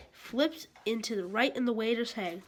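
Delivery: ordinary delivery, nothing marked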